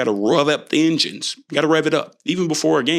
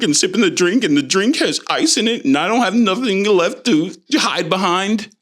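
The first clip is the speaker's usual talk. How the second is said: higher-pitched, mock-fretful run-on worrying; anxious excuses; 6 semitones higher than usual. Newcomer with nobody to hide behind, mock-anxious. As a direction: fret your way through a string of excuses.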